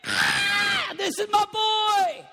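loud joyful shout; screamed declaration. Acted. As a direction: scream it out with delight. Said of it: "I'll scream it".

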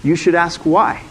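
ordinary delivery

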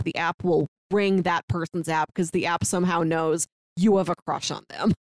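usual speaking voice

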